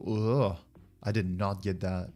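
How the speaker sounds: normal talking voice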